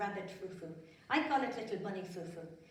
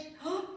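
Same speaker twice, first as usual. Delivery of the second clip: mock gasp; 9 semitones higher than usual. Mock shock at the bully.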